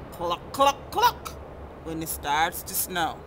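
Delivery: sing-song storybook read with character quotes; reading to children. Acted. Reading a snow picture book to kids, sing-song. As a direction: read it out gently to a young audience.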